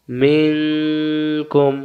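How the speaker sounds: মিং held long and nasal before কুম; hidden nun. Shown as a demonstration. Teacher reviewing the example, long nasal মিং then কুম. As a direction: hum through মিং, then close on কুম. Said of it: "গোপন করে পড়তে হয়".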